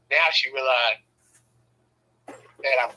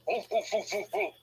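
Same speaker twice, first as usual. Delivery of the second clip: soft, higher oofs in a quick string; mock oof grunts; 4 semitones higher, 6 dB quieter than usual. Man acting out wrestling with his wife, soft mock oofs. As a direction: imitate little winded oofs. Imitates wrestling effort sounds.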